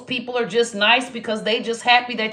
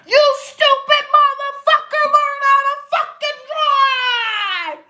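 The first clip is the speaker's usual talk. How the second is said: New York driver yelling. Retold or imitated. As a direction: bark at a driver who took a wrong turn. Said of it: "as soon as you make a wrong turn".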